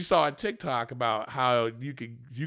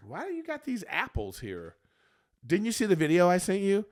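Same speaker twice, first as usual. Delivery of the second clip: mock nagging girlfriend; 7 semitones higher, 3 dB louder than usual. Mimicking Rachel's complaint.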